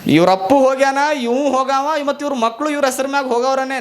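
the bond paper gloating over the dead owners; mocking bond paper. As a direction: crow over them with a gleeful jeer. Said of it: "ಅಂತದು bond paper".

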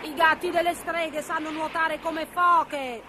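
high, slow witch voice; drawn-out high witch retort; sea witch. A narrator's reading. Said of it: "disse la strega marina".

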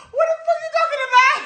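very high squealed mock disbelief; incredulous retelling. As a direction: squeal the baffled man's question, nearly cracking up. Retells baffled man amid laughter.

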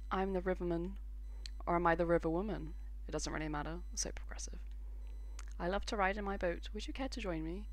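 a hint of a hushed riverboat figure; lightly voiced ferryman. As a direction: murmur it like an odd ferryman. Voicing the river ferry person.

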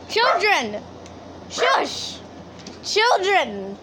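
high-pitched call to unruly pets; mock scolding. Real, not acted. Owner hushing dogs outside, mock scolding. Calling rowdy dogs to order.

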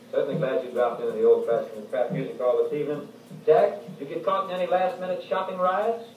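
usual speaking voice

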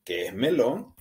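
ordinary delivery

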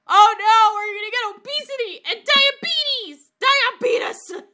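high-pitched comic alarm; hammed-up, high panicked whine; mock panic. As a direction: wail it in pretend dread. Acted mock panic over fast food.

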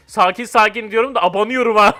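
ordinary delivery

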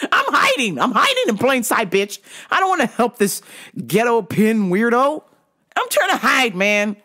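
high, comic outburst of complaint; mock indignant whine at being singled out; exasperated protest. Employee protesting being pointed out by the boss, exasperated.

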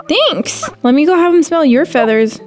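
ordinary talk

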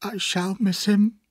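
high, slow grieving voice; tearful widow, drawn-out and high. A narrator's reading. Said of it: "with tears in her eyes".

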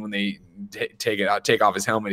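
ordinary talk, nothing marked

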